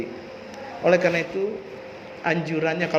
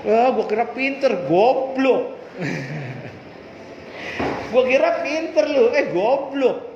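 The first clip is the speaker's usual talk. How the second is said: louder, higher, laughing jeer; mocking taunt; 5 semitones higher, 3 dB louder, slower than usual. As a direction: sneer at him like a mean classmate. Friends mocking the failed student.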